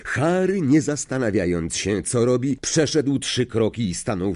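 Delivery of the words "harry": plain reading voice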